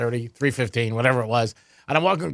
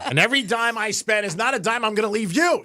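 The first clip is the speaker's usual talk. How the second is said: half-shouted joke at his daughter; joking outburst; 7 semitones higher than usual. Acted.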